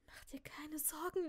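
whispered, distracted reassurance; eerie whisper. A narrator's reading. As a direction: whisper comfort absently.

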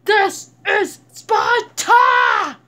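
drawn-out, full-throated movie shout; Sparta war cry. Acted. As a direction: bellow it slowly, word by word, like a warrior king. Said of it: "I can't do that voice".

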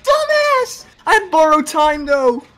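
high, loud panicked yelling; being chased. Real, not acted. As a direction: scream while fleeing the killer.

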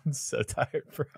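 soft, tired laugh through the words; quiet, giggly and worn out; laughing from exhaustion. Real, not acted. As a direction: laugh weakly at how tired you are. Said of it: "I'm laughing from how sad I am".

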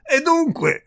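high, loud burst of triumph; triumphant. A narrator's reading. Visitor catching the countess out, triumphant. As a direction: pounce on it in triumph. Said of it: "in tono di trionfo".

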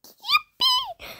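squeaky yippee. Retold or imitated. Game cheer.

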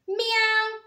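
high meow called down; cat meow. A narrator's reading. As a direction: meow down from the tree. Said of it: "he called down".

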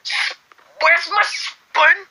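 high, stretched mock Scottish; Scottish accent. Hunting for a spoon, exaggerated Scottish accent. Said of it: "Scottish accent".

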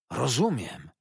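bright, eager Rozumiem; lively exclaim. A narrator's reading. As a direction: burst out with sudden understanding. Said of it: "zawołał to z taką żywością".

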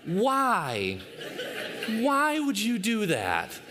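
drawn-out, incredulous questioning; imitated baffled parent. Parent to son after the confession, mock bafflement. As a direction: stretch it out in mock disbelief.

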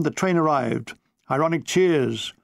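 usual speaking voice

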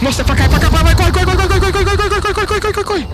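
loud frantic run run; being chased. Real, not acted. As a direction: shout at them to run.

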